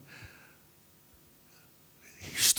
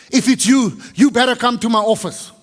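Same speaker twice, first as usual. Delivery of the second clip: stern public warning; 7 semitones higher, 5 dB louder than usual. Retold or imitated. Boss warns the gathered staff about a thief, loud and stern. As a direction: call it out over the room to flush out the thief.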